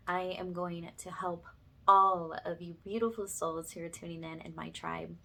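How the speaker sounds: normal talking voice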